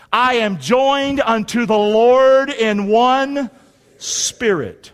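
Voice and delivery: slow, measured declaration in short phrases; congregational scripture recitation. Real, not acted. Preacher leads congregation reciting a verse, slow declaration. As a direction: lead a crowd reciting, phrase by phrase.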